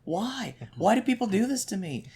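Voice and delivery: high-pitched, hammed-up lament; mock anguish. Fan lamenting yet another spoiler, mock-anguished pleading. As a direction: plead upward in pretend despair.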